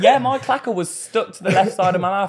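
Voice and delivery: high, loud, laughing through the words. Real, not acted. Joking with friends about being thirsty. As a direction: giggle through the joke.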